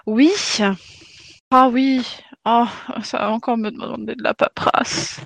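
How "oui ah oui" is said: ordinary delivery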